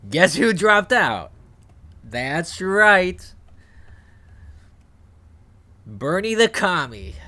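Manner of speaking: excited. Real, not acted. Teasing reveal to viewers.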